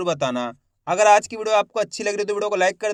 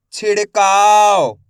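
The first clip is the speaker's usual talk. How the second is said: slow and louder, each sound stretched; slow blended demo; 8 dB louder, much slower than usual. Shown as a demonstration. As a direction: spell it out slowly for the class. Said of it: "शिक्षक शब्द जोड़कर पढ़ाता है". (ज़ोर से और धीरे-धीरे)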